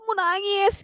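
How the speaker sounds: very high fangirl squeal; hammed-up overjoyed whine; happy near tears. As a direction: gush as if about to cry from joy. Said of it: "Aku bener-bener kayak mau nangis".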